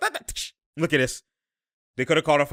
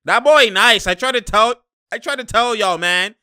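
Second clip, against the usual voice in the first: higher, louder excited call; hyped praise; 7 semitones higher, 6 dB louder than usual. Real, not acted. Streamer hyped about Trent's shot. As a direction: gas up the player.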